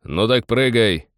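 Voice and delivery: slow, loud, desperate cheer; reckless shout. A narrator's reading. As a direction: yell it with wild glee. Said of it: "весело вдруг и с какой-то отчаянностью закричал".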